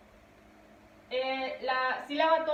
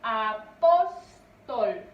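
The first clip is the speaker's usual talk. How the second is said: "Apóstol" slowly split, PÓS loud and long; a... PÓS... tol, punching the middle of "Apóstol"; stressed middle syllable; 3 semitones lower, 6 dB louder, much slower than usual. Shown as a demonstration. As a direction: hammer PÓS in "Apóstol", then fall away. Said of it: "su mayor fuerza de voz".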